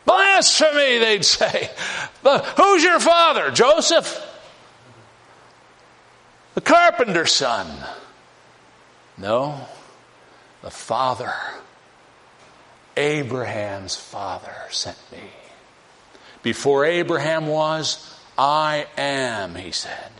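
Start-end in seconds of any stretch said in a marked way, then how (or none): 0.1-1.0 accusing cry. Acted. 2.2-7.7 mocking accusers. Mocking accusers question Jesus. 9.2-19.6 calm answer. Acted. Jesus answers calmly.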